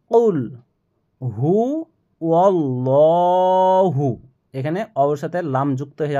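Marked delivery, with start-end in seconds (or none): 0.1-4.1 কুল হু ওয়াল্লাহু drawn out and joined; joined recitation. Shown as a demonstration. Teacher shows the joining, slow chant. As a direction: link the words and stretch ওয়াল্লাহু. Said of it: "কফ লাম পেশ".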